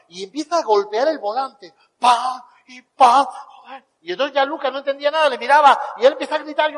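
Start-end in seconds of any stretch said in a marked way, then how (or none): 2.0-3.2 loud imitated thumps on the wheel; mock banging sounds. Mimicking hands hitting the steering wheel, loud thumps. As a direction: slap the wheel with your voice.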